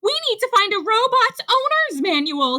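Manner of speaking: high, fast, urgent shout. A narrator's reading. Rabbit hunting for Robot's manual, shouting urgently. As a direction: call out in a panic. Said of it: "he shouted".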